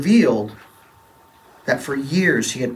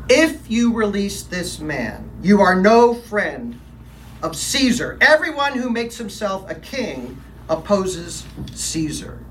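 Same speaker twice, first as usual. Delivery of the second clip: said coldly; 6 semitones higher, slower than usual. Acted. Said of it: "said coldly".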